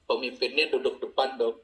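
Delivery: ordinary delivery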